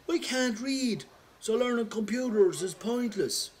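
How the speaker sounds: mocking son voice. Son's smart-aleck excuse quoted.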